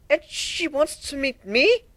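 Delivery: put-on high, slow, bashful young dragon; a little dragon asking, shy and hopeful; shy Spike.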